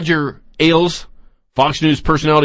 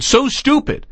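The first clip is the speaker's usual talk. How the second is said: exasperated; 10 semitones higher than usual. Real, not acted. Host ranting about guest.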